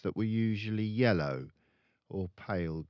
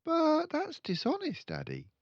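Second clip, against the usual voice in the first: put-on much higher, indignant child voice; shocked girl. Matilda protesting to her father, indignant child. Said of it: "Matilda said".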